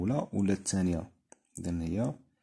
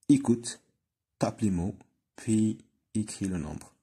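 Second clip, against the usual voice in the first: very slow, careful classroom reading; slow instruction read; 2 semitones higher, 4 dB louder, much slower than usual. Shown as a demonstration. Teacher reading the exercise instruction, very slow. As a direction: read it out slowly for children.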